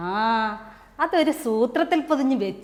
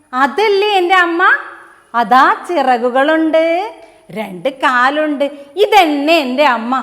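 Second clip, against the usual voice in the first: the chick thinks it found its mother, loud and eager; excited chick; 3 semitones higher, 7 dB louder than usual. Acted. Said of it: "കോഴിക്കുഞ്ഞ് പറഞ്ഞു".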